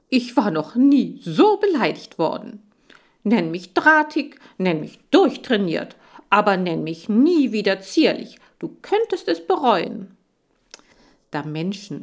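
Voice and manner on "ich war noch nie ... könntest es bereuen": higher, louder wounded pride; hammed-up outraged hamster; indignant hamster. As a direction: tough little hamster puffing himself up. Insulted hamster protests hotly.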